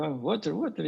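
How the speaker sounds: desperate tourist repeating water. Retold or imitated. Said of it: "Y yo".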